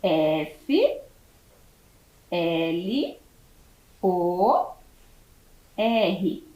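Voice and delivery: each letter said on its own, long pauses between; dictating letters to a class; spelling out letters. Shown as a demonstration. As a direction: spell it slowly, letter by letter, for young pupils. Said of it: "Como eu escrevo flor?".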